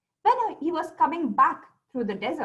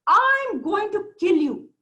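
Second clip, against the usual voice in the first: loud, high, drawn-out threat; angry jinn; 9 semitones higher, 7 dB louder, slower than usual. A narrator's reading. Jinn threatens the merchant with his scimitar, angry. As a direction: roar it slowly as a furious threat. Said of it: "full of anger".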